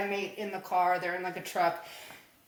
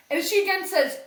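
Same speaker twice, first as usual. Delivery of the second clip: normal talking voice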